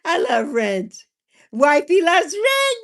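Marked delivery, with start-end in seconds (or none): 1.5-2.8 loud, slow, stretched-out cry of joy; excited shout. Real, not acted. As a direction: belt it out like a proud fan.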